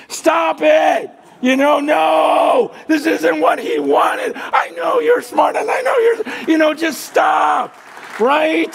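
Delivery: high, loud urgent yell. Pretend. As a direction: shout them down, pleading for peace. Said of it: "I'm just gonna start yelling".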